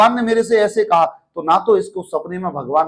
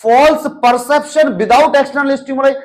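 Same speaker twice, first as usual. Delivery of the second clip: slow emphatic definition; 6 semitones higher, 4 dB louder, much slower than usual. Shown as a demonstration. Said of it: "आजाद भाई इससे क्या समझ रहे हो तुम".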